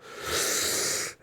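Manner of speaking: exaggerated exasperated sigh; long put-on sigh at someone's stupidity; loud audible sigh. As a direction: heave a big, audible mock sigh. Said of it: "give a very loud and audible".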